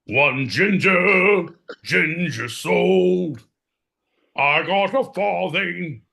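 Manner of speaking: high, loud, slow street hawker; market crier. Acted. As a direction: cry the wares across a Victorian market. Crier sells the boy.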